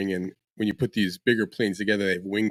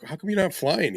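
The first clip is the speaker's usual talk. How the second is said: puzzled question; 8 semitones higher than usual. Retold or imitated. Retold question to buddy.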